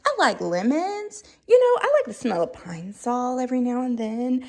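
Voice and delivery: a sing-song polite cheer; mock chipper reply. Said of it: "you're like".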